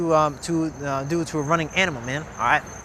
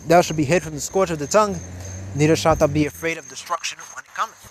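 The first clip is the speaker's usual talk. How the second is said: louder, declaiming the verses; scripture reading; 6 dB louder than usual. Acted. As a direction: proclaim the verses from the pulpit. Reads scripture aloud.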